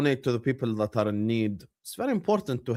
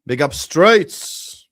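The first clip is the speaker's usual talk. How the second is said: slow, louder stretched shoutout; drawn-out shoutout; 8 dB louder, much slower than usual. Real, not acted. Streamer giving a stretched-out shoutout to a viewer. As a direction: stretch the name out.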